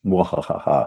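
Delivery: slow, hammed-up villain's "mwahaha"; mock evil laugh. Guest gloats to the interviewer over fooling people, playful evil laugh. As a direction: laugh like a scheming cartoon villain.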